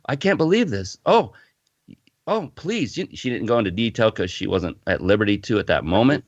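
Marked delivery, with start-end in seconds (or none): 0.0-2.9 shocked dismay. Retold or imitated. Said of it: "She goes".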